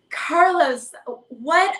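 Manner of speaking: surprised. Real, not acted. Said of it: "I don't even know what to say".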